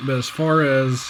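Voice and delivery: ordinary talk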